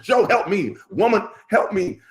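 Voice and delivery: mock desperate call to his wife for help; hammed-up plea. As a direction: act the starving man begging to be fed. Comic plea, playing the hungry man.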